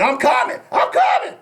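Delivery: squeaky high "I'm coming"; high excited quote. Retold or imitated. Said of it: "sent me a text message saying".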